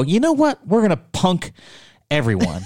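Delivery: high-pitched sarcastic announcement; mock scheming. World leaders agreeing to punk everyone, mocked incredulously. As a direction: pitch it way up, mocking a grand plot.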